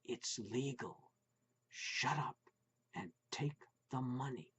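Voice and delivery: soft, low voice from the dark; eerie whisper. A narrator's reading. Hidden voice urging Putz to take money, soft sinister whisper. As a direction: hiss it like a tempter.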